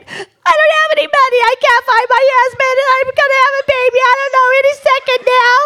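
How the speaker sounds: high, rapid mock crying; comic hysterical sobbing; bawling. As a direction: bawl it out in a squeaky wail. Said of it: "I start bawling and crying".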